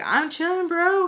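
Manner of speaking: high, pushy bro voice; protesting. Retold or imitated. Acted friend insisting he is staying.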